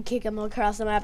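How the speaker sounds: normal talking voice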